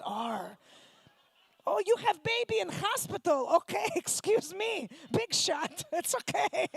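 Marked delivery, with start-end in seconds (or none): 1.6-6.7 lightly voiced Russian mother, higher; dismissive Soviet mother; a hint of Russian accent scoffing; Russian mom. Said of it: "my Soviet mom".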